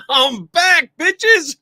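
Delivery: loud, high, laughing greeting; excited laughing shout. Real, not acted. Host greets audience, laughing.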